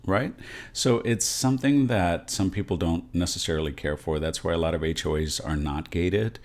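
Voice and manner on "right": ordinary talk, nothing marked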